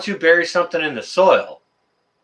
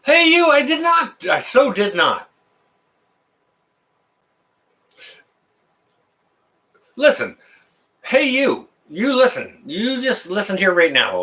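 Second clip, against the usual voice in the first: worked-up scolding; 8 semitones higher than usual. Real, not acted. Yelling at the bunnies in his yard, worked up and high. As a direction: scold the bunny like it can hear you. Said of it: "I was getting a little bit excited there".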